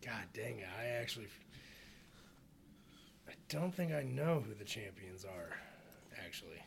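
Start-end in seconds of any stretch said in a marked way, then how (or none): 0.0-4.0 annoyed whisper. Real, not acted. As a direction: mutter it under your breath. Annoyed at not knowing.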